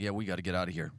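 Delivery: lightly voiced, very low undertone; a low aside, imitating a listener; grumbling listener. Congregant deciding to leave the sermon, lightly voiced low grumble.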